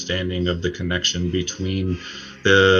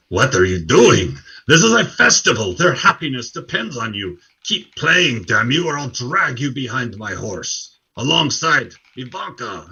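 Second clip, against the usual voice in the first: high, loud tirade with swooping pitch; raging burgomaster; 5 semitones higher, 5 dB louder than usual. Acted. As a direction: threaten the musician as a furious baron. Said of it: "The burgomaster's rage subsides".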